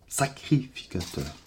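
"Sacrificateurs" drawn out slowly and softly; mot testé lentement. Acted. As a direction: test how "Sacrificateurs" sounds, stretching it out. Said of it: "Ça sonne bizarre quand je le dis". (« Sacrificateurs » étiré lentement, à voix basse)

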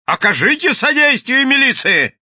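high, loud official appeal; shout. A narrator's reading. Policeman shouting to the crowd for assistance, urgent shout. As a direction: call out for help at full voice. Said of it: "крикнул он".

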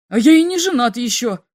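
loud indignant shout. A narrator's reading. Second alien yells back at Slop, loud. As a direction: yell it back at the rival. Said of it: "закричал второй".

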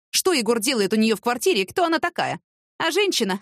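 ordinary delivery, nothing marked

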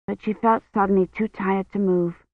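usual speaking voice

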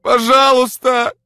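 high screamed pleas; anguished woman crying out word by word; desperate shout. A narrator's reading. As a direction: call after him in despair, very loud. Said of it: "крикнула она в отчаянии".

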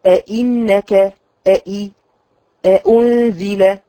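أأنزل slow, each hamza stopped clearly; تحقيق الهمزتين; both hamzas. Shown as a demonstration. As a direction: sound both hamzas of أئنك separately. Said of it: "حققي الهمزتين".

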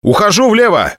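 high, urgent turn report; combat callout. Acted. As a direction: call the break over the radio. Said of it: "доложил я".

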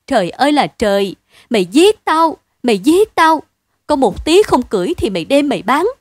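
loud, high wailing cries; wailing lament. A narrator's reading. Wife lamenting the sold loom, loud wailing accusation. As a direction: wail to heaven and accuse him. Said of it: "vợ anh rên rỉ".

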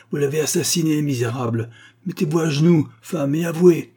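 high, loud commanding outburst; furious accusation. A narrator's reading. Accuser orders Rosa to confess. (accusation impérieuse, voix forte)

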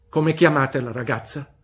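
high, loud, brusque "Bene?"; stern old aunt. A narrator's reading. Aunt Betsy demanding news from the mother, brusque. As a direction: demand an answer like a sharp old lady. Aunt asks the girl's name.

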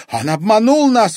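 high, loud outcry; crying out. A narrator's reading. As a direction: cry out the accusation in alarm. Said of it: "вскричала баронесса".

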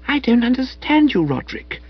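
put-on high, bewildered girl; Madeline's voice. A narrator's reading. Madeline puzzled by Spode, high and bewildered.

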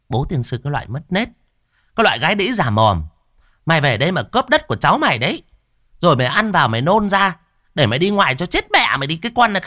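fast, loud furious tirade; angry cursing. Acted. Sister-in-law cursing Cúc over land, furious rant. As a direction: curse her out fast and hard. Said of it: "tức lắm, chống nạnh mà chửi".

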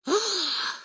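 sharp surprised gasp; a sudden startled intake of breath. A narrator's reading. Рассказчик изумлён узнаванием. (резкий изумлённый вдох)

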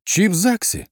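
high-pitched call by full name; shout. A narrator's reading. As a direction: call out to someone below. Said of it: "крикнула я".

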